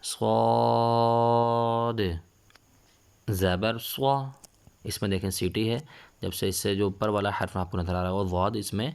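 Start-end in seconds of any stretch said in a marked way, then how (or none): 0.0-4.3 slow, long-held letter names chanted loud; Qaida recitation drill. Shown as a demonstration. Teacher leading children through letters, loud and drawn out. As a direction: stretch every letter name out for the class.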